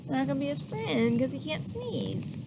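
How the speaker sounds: ordinary delivery, nothing marked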